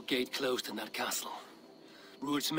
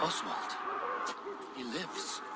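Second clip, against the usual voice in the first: whisper; 6 dB quieter than usual. Acted. Stunned discovery of Oswald.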